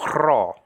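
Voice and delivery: rho with a rough, trilled R at the start; rolled Greek R. Shown as a demonstration. Introducing the Greek letter, rho with a trilled R. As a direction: roll the R hard in rho. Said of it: "Say rho".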